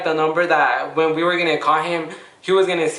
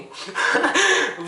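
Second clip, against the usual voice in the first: high laugh; 10 semitones higher than usual. Real, not acted. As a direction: burst out laughing at your own excuse. Laughing at own excuse.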